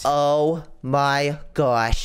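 long, slow, stunned oh my gosh; slow drawn-out gasp. Acted. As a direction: stretch every word out in shock. Gasping at the miner's death.

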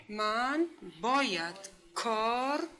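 slow, drawn-out dictation; word by word. Shown as a demonstration. Teacher writing a sentence for pupils, word by word. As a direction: read each word separately with pauses.

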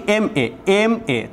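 এমএ drawn out slowly, starting on an e vowel; slow sounding out of the abbreviation; vowel start demo. Shown as a demonstration. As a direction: stretch এমএ so the opening e is heard. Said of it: "উচ্চারণটা কিসের মতো হচ্ছে".

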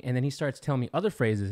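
ordinary talk, nothing marked

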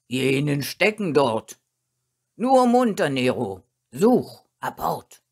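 rousing calls to a dog; urging commands. A narrator's reading. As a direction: bark out orders to fetch. Master orders dog to fetch.

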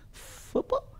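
soft, almost whispered, imitated puzzlement; mock bewilderment, barely voiced; puzzled question. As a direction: ask it very quietly, as a baffled foreigner. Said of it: "And he goes".

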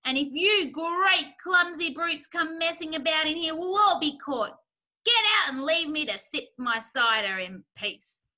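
high, loud irritable scolding; shrieking rant. A narrator's reading. Rat ordering the animals out of the cellar, shrieking. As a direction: rail at the clumsy intruders. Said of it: "shrieked Rat".

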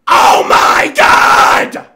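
huge stretched yell; shout. Real, not acted. Streamer reacting to a clip, full shout. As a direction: scream it in disbelief.

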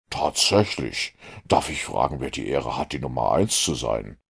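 low, lightly curt question; a touch of gruffness. A narrator's reading. Holmes to Mortimer, a bit brusque. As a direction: let a hint of offence show. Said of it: "mit einem Anflug von Schroffheit".

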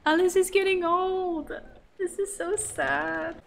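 high, drawn-out lament; sorrowful whine. Real, not acted. Realizing Alice is growing old.

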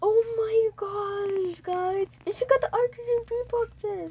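excited. Real, not acted. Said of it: "super excited".

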